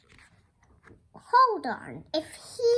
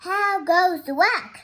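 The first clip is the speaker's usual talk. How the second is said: loud, higher farmer's call; raised storybook holler; 6 semitones higher, 9 dB louder than usual. Acted.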